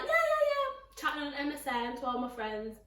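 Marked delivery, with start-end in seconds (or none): 0.0-0.7 put-on chatty teen.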